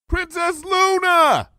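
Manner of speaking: high slow call, stretching Luna; long high hail. A narrator's reading. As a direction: call down to her from far above. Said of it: "I called out".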